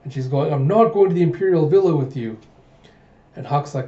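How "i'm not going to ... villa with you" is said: louder, higher acted protest; indignant refusal. Princess rejecting a suitor's invitation, indignant. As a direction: refuse firmly, voice pushed up.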